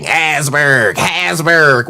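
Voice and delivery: "Hasberg" repeated with a buzzing Z; mocking mispronunciation. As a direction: mimic the botched "Hasberg", Z in the middle. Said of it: "You like to put Z's in everything".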